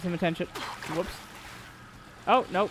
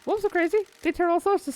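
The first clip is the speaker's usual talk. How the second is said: high-pitched, eager character voice; excitable game character; 12 semitones higher than usual. Acted. Character insisting wolves are to blame, high excitable voice. Acting out both sides of dialogue.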